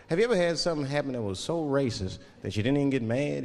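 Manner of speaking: ordinary talk, nothing marked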